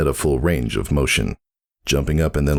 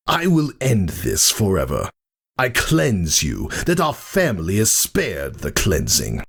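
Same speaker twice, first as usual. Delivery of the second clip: higher, cold zealous threat; a brother about to kill his sister; Thunderhead's menacing voice; 7 semitones higher than usual. Acted. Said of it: "growled Thunderhead".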